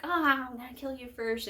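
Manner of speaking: a touch of mock outrage; mock angry threat. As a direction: imitate a mad rival, half-hearted. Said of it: "the other tribute gets mad".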